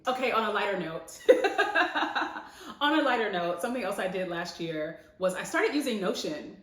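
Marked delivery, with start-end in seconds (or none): 1.2-2.8 a bright, high burst of laughter. Real, not acted. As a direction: laugh out loud at your own joke. Laughs at her own joke.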